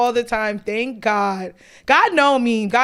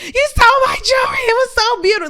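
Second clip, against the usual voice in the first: high, loud mock scream; much higher screamed mock Karen accusation; 13 semitones higher, 4 dB louder than usual. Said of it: "screaming her fucking head off".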